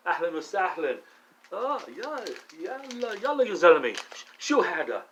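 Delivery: high, swooping exclamations in Arabic; animated Arabic phrases. Shown as a demonstration. Showing off a little Arabic from meeting Muhammad Ali, animated.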